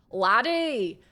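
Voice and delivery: imperfectly imitated Scottish brogue; attempted Scottish accent. Shown as a demonstration. Streamer trying to show chat the Scottish R, attempted Scottish accent. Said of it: "I can't even imitate it".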